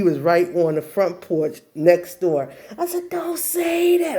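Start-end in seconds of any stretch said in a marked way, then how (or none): 3.1-4.2 high, slow plaintive wail; mortified protest. Retold or imitated. Girl begs mom with crush next door, mortified wail. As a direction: beg her, stretching the words.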